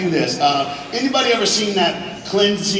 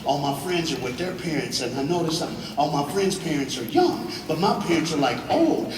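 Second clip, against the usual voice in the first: normal talking voice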